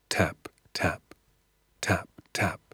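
soft, whispery taps; quiet, suspenseful tapping; hushed footsteps. A narrator's reading. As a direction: tap out footsteps in a near whisper. Narrator voicing distant footsteps at night.

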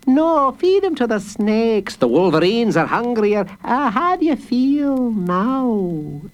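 high, mocking cruelty; cruel jailer woman. A narrator's reading. Mistress Fowl taunting the boy, mocking. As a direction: taunt sweetly.

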